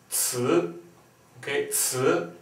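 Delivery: ci steigend im zweiten Ton; second tone. Shown as a demonstration. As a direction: ci nach oben ziehen. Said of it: "Second tone".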